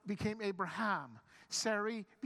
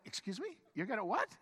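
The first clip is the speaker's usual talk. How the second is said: incredulous; 2 semitones higher than usual. Acted. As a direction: react as a newcomer taken aback, pitch jumping up. Acted stunned reaction of newcomer.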